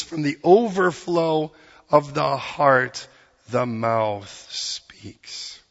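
very slow, deliberate quotation; scripture quoted weightily. Retold or imitated. Preacher quotes Jesus on the heart, slow and weighty. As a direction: draw each phrase out slowly, giving it weight. Quoting Jesus's saying emphatically.

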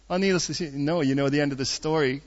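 ordinary delivery

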